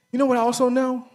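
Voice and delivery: high, loud refrain; rhetorical peak. Real, not acted. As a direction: hit the peak of the build.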